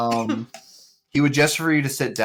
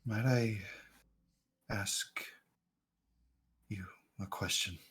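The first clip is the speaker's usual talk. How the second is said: put-on quiet, hesitant old stranger; soft blind stranger; 6 dB quieter than usual. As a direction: speak softly like a wary blind man. NPC asks softly.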